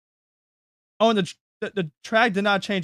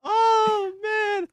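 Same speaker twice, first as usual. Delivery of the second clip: laughing; 14 semitones higher than usual. Real, not acted. Still laughing after spit-take.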